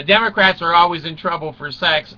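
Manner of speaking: normal talking voice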